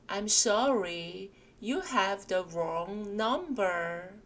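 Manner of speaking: very slow, spaced read of "I'm sorry, you have the wrong number"; slow repeat drill. Shown as a demonstration. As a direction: drill it slowly for learners to copy. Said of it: "붙여서 같이 읽어보실게요". (천천히 따라 읽기)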